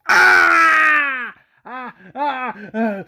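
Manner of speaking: long loud high scream, then short cries; high acted screams of pain. A narrator's reading. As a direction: scream as if shot, trailing into yelps. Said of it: "Ryan screams".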